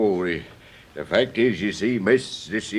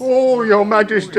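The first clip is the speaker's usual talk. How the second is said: high, louder desperate wail; terrified plea; 11 semitones higher, 5 dB louder than usual. Acted. Condemned gardener pleads with the Queen, high terrified wail. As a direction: beg for mercy. Gardener begs for his life.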